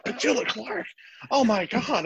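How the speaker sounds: high-pitched, amazed exclamation; astonished dad. Retold or imitated. Dad reacts to hearing [name]'s name, amazed. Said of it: "He's like".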